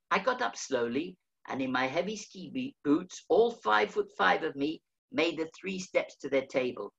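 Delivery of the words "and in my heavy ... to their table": usual speaking voice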